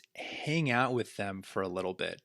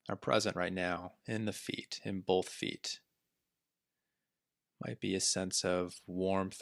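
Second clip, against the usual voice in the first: calm body scan guidance with long pauses; unhurried meditation teacher, letting each phrase settle; guided meditation. Acted. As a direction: guide listeners gently through the body, leaving space between phrases.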